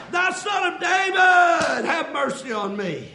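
long, high drawn-out cry; fervent preacher's wail of supplication; crying and calling. Retold or imitated. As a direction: call out slowly, stretching the name. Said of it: "looking and crying and calling".

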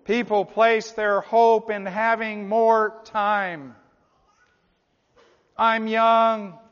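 loud, slow, stretched-out sermon voice; forceful preaching. Real, not acted. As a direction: proclaim it to the whole congregation.

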